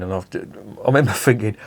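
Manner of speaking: ordinary talk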